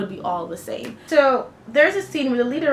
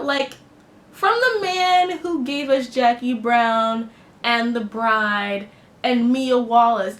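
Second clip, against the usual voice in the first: ordinary talk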